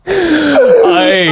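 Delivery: high helpless laughter; cracking up. Real, not acted. Joke teller cracking up at his own punchline in high laughter.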